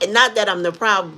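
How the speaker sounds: ordinary talk, nothing marked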